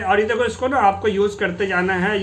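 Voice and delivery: ordinary talk, nothing marked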